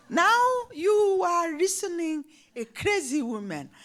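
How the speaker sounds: loud, high laughing declaration; laughing exclaim. Real, not acted. Speaker laughing to the audience about being called crazy. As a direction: laugh it out proudly.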